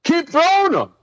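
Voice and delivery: high, mocking coach voice with a laugh creeping in; sarcastic coach needling a struggling rookie, laughing; mimicking coach BA. Retelling coach mocking quarterback, high sarcastic encouragement. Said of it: "B A popped up and was like".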